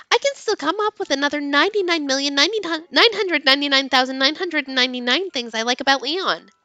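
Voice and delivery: usual speaking voice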